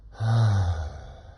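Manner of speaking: a sleepy Sunday-morning "uh"; relaxed schwa. Shown as a demonstration. Teacher demonstrates the gentle first sound, relaxed. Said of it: "I'll do it again".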